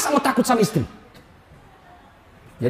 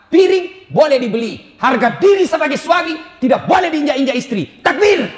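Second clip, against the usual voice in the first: rousing preacher shout; 5 semitones higher than usual. Real, not acted. Preacher rallying the congregation, shouting. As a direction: raise the crowd's spirit, full voice. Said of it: "preacher rousing crowd".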